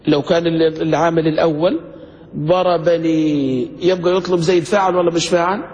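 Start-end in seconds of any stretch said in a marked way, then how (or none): 2.4-3.6 ضربني stretched very slowly; slow recitation. Shown as a demonstration. Teacher reads the example word to the class, very slow. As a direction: lay out each syllable. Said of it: "اقراها كيف بتجي".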